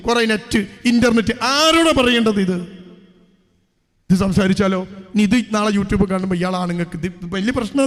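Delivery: usual speaking voice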